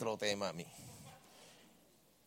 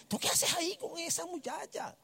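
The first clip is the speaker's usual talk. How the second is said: high, soft teasing gossip voice; mock-scolding girls from his courses; imitating classmates; 12 semitones higher, 8 dB quieter than usual. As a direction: pitch it up like nosy classmates. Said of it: "Las muchachas de mis cursos".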